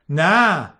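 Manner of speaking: shouted no. A narrator's reading. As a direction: yell no into the phone. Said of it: "آنقدر بلند فریاد زد".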